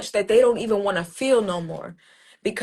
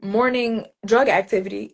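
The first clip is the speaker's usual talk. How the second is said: ordinary talk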